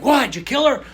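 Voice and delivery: high, loud, stunned question; cousins shouting. Retold or imitated. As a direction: blurt it out in shock. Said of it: "they're like".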